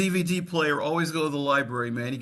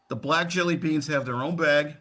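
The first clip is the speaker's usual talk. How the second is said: usual speaking voice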